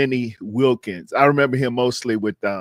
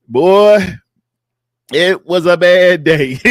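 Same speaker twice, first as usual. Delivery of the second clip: emphatic loud; 4 semitones higher, 8 dB louder, slower than usual. Real, not acted. Recalling being called up.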